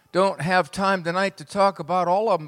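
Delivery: usual speaking voice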